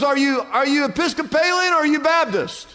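high-pitched acted question; hammed-up eager passerby. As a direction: press on excitedly for common ground.

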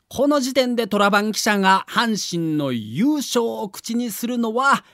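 ordinary delivery

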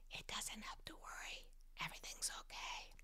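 soft bedtime whisper; hushed, soothing whisper to fall asleep to. Shown as a demonstration. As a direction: whisper it like a message to play at night. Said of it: "Put this on your, on your phone at night".

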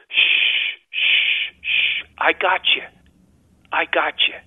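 long hushes, then gentle reassurance; soothing father. Retold or imitated. Father holding his son after a nightmare, soothing hush. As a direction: imitate a father shushing a scared child. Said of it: "all he kept saying was".

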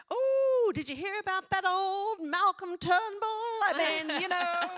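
high, loud, plummy mock impression of mum; daughter impersonating mum. Daughter mocking her mum's chat, high and loud. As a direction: ham it up as the mother, high and loud. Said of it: "she strutted around impersonating me".

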